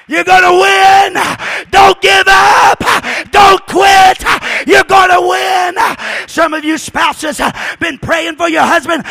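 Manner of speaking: loud, high, shouted preaching; rally the congregation at the top of your voice; fiery revival shout; full-force preaching. Acted.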